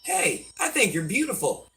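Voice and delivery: a hint of a charming gentleman; mock real man. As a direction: play the polite admirer paying a compliment. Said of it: "A real man would say".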